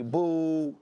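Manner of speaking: slow drawn-out imitation of a booing crowd; imitating a jeering crowd, drawn out and slow; mock crowd booing. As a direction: stretch out the boos like a jeering stadium. Imitating hostile stadium crowd.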